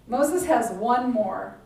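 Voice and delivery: ordinary talk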